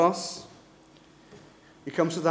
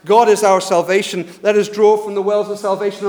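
loud, raised crowd response; shouting back; 4 semitones higher, 11 dB louder than usual. Retold or imitated. People answering the priest's call, shouting back. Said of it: "the people would shout back".

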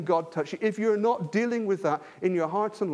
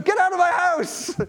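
high, loud mock scream; shrieked get out; retold scream; 10 semitones higher, 6 dB louder than usual. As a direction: yell it like an outraged homeowner. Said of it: "he screamed at them".

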